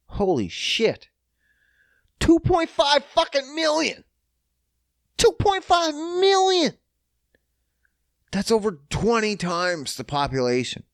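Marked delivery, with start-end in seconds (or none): 2.2-4.0 high, louder disbelief; can't believe the number; stunned at Vancouver's size; shocked. Real, not acted. Shock at population. 5.2-6.7 high, louder and slow disbelief; dumbfounded repeat; stunned. Real, not acted.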